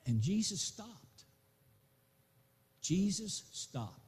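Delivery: dramatic pause. Acted. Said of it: "preacher slows for effect".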